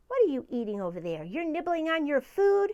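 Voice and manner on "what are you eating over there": ordinary delivery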